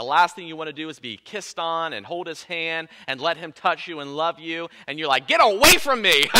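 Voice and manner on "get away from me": higher and louder, pushing him away; irritated brush-off. Retold or imitated. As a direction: snap it out like a hurt wife. Wife rebuffing husband, acted quote.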